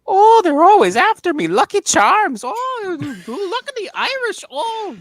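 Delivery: put-on high squeaky leprechaun Irish accent; shrieking hammed-up Irish accent, very high and loud. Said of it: "this is my impression".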